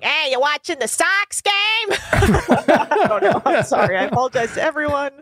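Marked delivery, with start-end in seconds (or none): 0.0-1.5 Boston sports-fan voice; a quick Boston "Sox game" guy; Boston fan asking about the Sox; Boston accent. Retold or imitated. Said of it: "They used to be like". 1.9-4.0 laughing. Real, not acted. Laughs at own bit.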